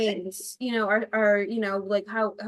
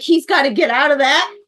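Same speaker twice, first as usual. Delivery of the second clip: louder, worried cry for the actor; alarmed outburst; 4 semitones higher, 11 dB louder than usual. Retold or imitated. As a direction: blurt it out in alarm. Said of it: "she's like".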